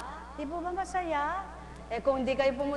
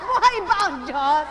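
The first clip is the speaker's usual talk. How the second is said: 1 laughing call; 2 semitones higher, 7 dB louder than usual. Real, not acted.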